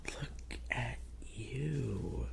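slow, soft whisper; admiring whisper. Acted. Admiring a shy partner, slow whisper. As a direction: whisper it, stretching every word out.

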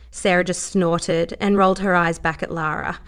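ordinary delivery

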